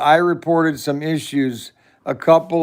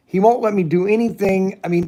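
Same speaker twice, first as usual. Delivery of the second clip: normal talking voice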